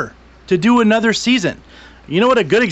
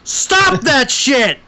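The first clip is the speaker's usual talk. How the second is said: slow, drawn-out scolding; 4 semitones higher, much slower than usual. Real, not acted. Ranting at Netflix over short seasons, slow scolding. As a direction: stretch every word out slowly.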